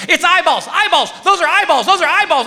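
high, loud frantic guessing; wife yelling guesses. Retold or imitated. Wife yelling guesses during Pictionary, frantic. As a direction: shout guesses at the drawing. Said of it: "my wife's like".

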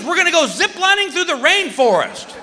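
cheery wife voice. Retold or imitated. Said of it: "She goes".

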